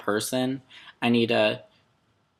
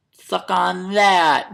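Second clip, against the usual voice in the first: taunt; 8 semitones higher, 7 dB louder, much slower than usual. Pretend. Mock taunt.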